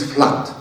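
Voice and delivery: 'flood' with an open short vowel, like 'flat'; 'flood' as the model pronunciation; corrected 'flood'. Shown as a demonstration. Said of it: "the right pronunciation".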